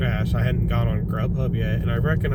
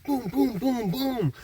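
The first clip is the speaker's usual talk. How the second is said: high-pitched, softer boom-boom noises; imitated banging; 11 semitones higher, 5 dB quieter, slower than usual.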